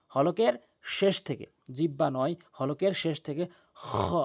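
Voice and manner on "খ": খ scraped from the back of the throat; letter demonstration. Shown as a demonstration. Teacher demonstrating the letter to students, slow and careful. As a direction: rasp খ from deep in the throat. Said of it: "এভাবে উচ্চারণ করবেন".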